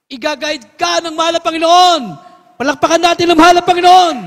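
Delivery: loud, high preaching at full force; pulpit-pounding revival preaching; fiery preaching. Acted. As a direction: stir the congregation up to clap.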